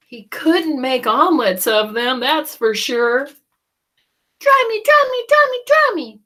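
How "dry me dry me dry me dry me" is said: very high, loud pleading chant; a wet chick begging; soggy chick crying. Acted. Chick begging Jack to dry it. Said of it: "cried the soggy baby chick".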